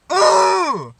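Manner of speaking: long, high, loud groan of frustration; exasperated yell. Acted. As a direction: let out a drawn-out exasperated cry. Exasperated end of the rant.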